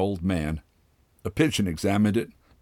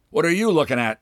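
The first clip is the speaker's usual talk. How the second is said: confrontational; 7 semitones higher, 3 dB louder, faster than usual. Acted. Father confronting staring woman.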